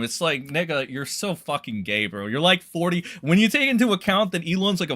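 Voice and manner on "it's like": usual speaking voice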